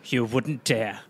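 low, quiet royal outrage; low mock defiance. Young Charles defying his mother, low mock outrage. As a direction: drop low as an affronted mock prince.

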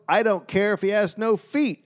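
high-pitched mock retort; imitated yell back. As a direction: toss it back as a pretend shout. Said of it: "And Cobb yelled back".

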